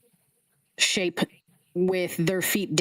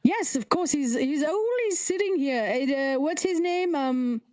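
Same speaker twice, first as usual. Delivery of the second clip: high flustered head; 5 semitones higher than usual. Acted. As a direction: ramble in a high muddled voice. High head rambling names.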